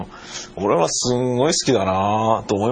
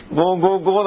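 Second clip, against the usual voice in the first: mock cop order; 9 semitones higher, faster than usual. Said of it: "なんて".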